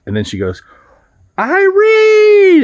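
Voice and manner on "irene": high, drawn-out scream of a name. Retold or imitated. Lady at the door yelling for her teenage daughter, screaming. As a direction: scream it like an outraged mother calling out. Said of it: "And she's just screaming".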